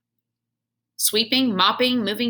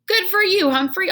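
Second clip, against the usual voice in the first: much higher, louder approval; chuckling janitor; 12 semitones higher, 5 dB louder than usual. Acted. Janitor praising the hamster, chuckling. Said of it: "Aldo chuckled".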